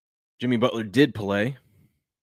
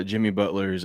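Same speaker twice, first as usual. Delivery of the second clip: normal talking voice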